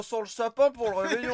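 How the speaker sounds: hammy theatre actor line; theatrical stage delivery. Pretend. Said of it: "t'as les comédiens qui font".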